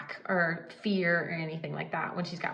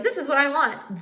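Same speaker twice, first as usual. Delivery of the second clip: demanding; 7 semitones higher, 6 dB louder than usual. Retold or imitated. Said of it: "and be like".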